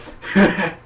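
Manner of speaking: short high mock cackle; imitated villain laugh. Reviewer showing villain's laugh, brief imitated burst. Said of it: "because he was like".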